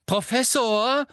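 high, drawn-out, rising call; eagerly officious. A narrator's reading. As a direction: call for the teacher's attention keenly. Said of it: "sagte Malfoy beflissen".

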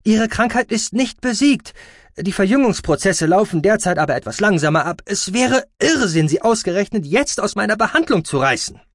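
high, loud protest; strident, officious medic; indignant doctor. Acted. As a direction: argue insistently against them. Doctor angrily objects to removal.

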